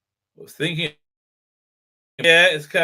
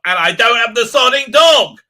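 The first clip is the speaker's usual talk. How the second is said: loud, raised-pitch rant; exasperated outburst; 5 semitones higher, 7 dB louder than usual. Retold or imitated. Bruised owner without the dog, exasperated outburst. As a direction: burst out in mock fury.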